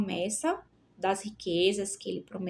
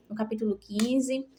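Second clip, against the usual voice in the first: ordinary delivery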